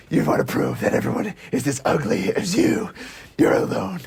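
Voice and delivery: raspy, voiceless vigilante growl; Batman. Acted. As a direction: rasp it out like a masked hero. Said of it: "I love when Batman says".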